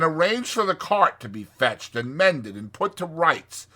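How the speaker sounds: brisk, scolding Rat voice; sharp. A narrator's reading. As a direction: order Toad about sharply. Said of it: "said the Rat sharply".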